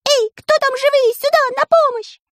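very high shouted bird call; bird calling help. A narrator's reading. Siskin calls for help.

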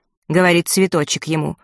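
plain reading voice

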